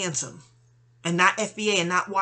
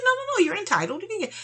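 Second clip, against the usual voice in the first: higher, eager reassurance; pitched-up insisting lawyer; 13 semitones higher, faster than usual. Retold or imitated. Attorney telling her she's entitled, higher and eager.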